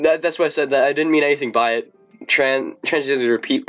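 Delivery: ordinary delivery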